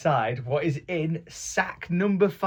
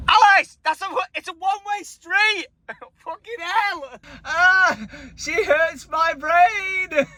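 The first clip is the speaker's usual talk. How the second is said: much higher exasperated shout; mock exasperation; 12 semitones higher, slower than usual. Brother mock-exasperated at sister Alice, high shout. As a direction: throw up your hands at her.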